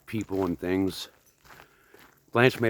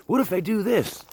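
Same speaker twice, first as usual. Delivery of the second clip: much higher, put-on anxious question; mock worried bouncer; 12 semitones higher than usual. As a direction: play a nervous rookie asking the boss. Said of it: "what Patrick Swayze said in Roadhouse".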